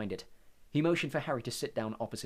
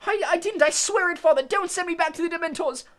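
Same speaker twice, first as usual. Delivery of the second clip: high, loud panicked shriek; a terrified boy begging his father; frantic shriek, far higher; 11 dB louder than usual. Acted. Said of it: "shrieked the boy".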